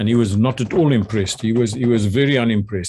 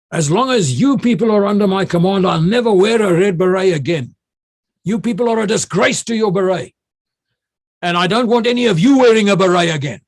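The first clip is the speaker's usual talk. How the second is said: higher, louder theatrical outrage; officer staging outrage before his paratroopers, higher and louder; melodramatic angry tirade; 8 semitones higher, 5 dB louder than usual. Retold or imitated. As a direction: ham up the disgrace like a furious commander. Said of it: "I got to be melodramatic now".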